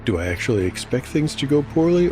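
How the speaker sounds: plain reading voice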